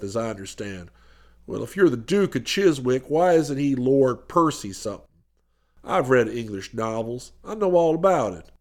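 stout, suspicious businessman, pitched low; determined businessman. A narrator's reading. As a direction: press the point in a low, businesslike voice.

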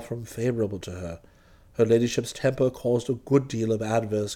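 very low, measured deferential voice; unruffled valet. Acted. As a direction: explain with calm, formal dignity. Jeeves speaks freely after dismissal.

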